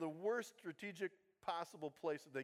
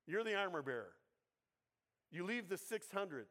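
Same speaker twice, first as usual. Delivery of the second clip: ordinary delivery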